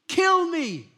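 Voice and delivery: sudden high, loud plea of despair; despairing cry. Acted. Depressed Elijah begging God to end it, crying out.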